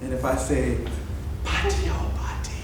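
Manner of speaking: soft whispered call, very quiet; storyteller whispering the call to the kids. Shown as a demonstration. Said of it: "real low and almost at a whisper".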